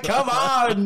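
high, drawn-out come on; hammed-up raver cheer; mock hype shout. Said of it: "I was like".

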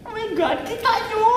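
high, giggly squeal; thrilled. Real, not acted. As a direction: show it off, squealing and laughing. Showing viewers the new phone. (kinikilig na tili)

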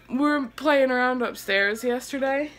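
ordinary delivery

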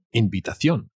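ordinary talk, nothing marked

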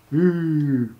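playful fake disapproval; mock scandalised. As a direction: pretend to be shocked. Mocks shock at pro using bridge camera.

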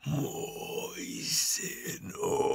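slow unvoiced whisper from far away; ghostly voice of the dead. A narrator's reading. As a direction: breathe it out like a corpse calling from the grave. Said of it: "Hlas jako by se táhl z velké dálky". (šeptavý hlas mrtvého z dálky)

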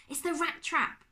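put-on high, alarmed young boy; nervous boy voice. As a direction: blurt it out as a scared boy. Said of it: "Bertolt said".

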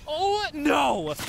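high, loud wail of dismay; dismayed cry. Real, not acted. Player watching the plan fail, loud dismayed cry. Sudden mishap in game.

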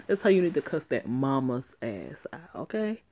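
conspiratorial whisper; hushed egging-on; whispered aside. Real, not acted. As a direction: whisper it like a secret plan. Urging player, conspiratorial aside.

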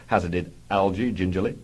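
ordinary talk, nothing marked

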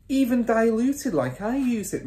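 high-pitched warning; mother's voice. A narrator's reading. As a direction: warn him as the wife. Mother warns husband.

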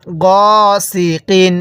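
long held "গ", then "সি, কিন"; drawn-out vowel. Shown as a demonstration. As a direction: stretch "গ" before "সি, কিন". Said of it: "এরকমভাবে শিখব".

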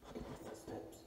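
tense frightened whisper. Real, not acted. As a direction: whisper under your breath. Hears footsteps, hiding.

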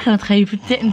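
ordinary delivery

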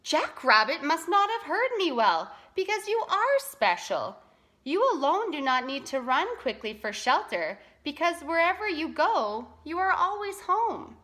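lightly acted creator building someone up; reassuring Great Spirit. A narrator's reading. Great Spirit tells Tortoise he is special, reassuringly.

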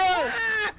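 high loud scream; terrified scream. Real, not acted. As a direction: scream as she catches you. Being caught while chased.

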